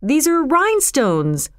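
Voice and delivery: high, dismayed exclamation; crying out. A narrator's reading. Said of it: "cried John".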